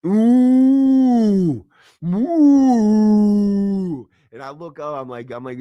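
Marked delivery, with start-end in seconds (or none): 0.1-4.1 long, loud, high hoot; drawn-out animal-like "ooh"; imitated animal howl. Imitating inmate heard while waking up, animal howl. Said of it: "he's making animal noises".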